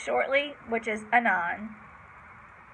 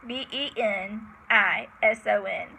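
usual speaking voice

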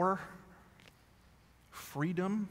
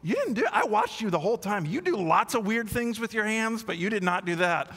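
higher, dry reassuring wife; higher-pitched wife, matter-of-fact; wife's voice; 7 semitones higher, 4 dB louder than usual. Retold or imitated. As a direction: set him straight, a bit higher. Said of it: "And she goes".

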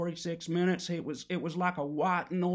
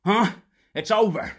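shouted; 3 semitones higher, 10 dB louder than usual. Acted. Shouting final word to Gary.

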